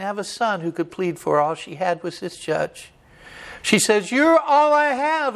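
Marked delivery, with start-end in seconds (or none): 4.1-5.3 high, slow desperate appeal; widow's plea. Retold or imitated. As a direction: throw yourself on his mercy. Widow pleading with judge.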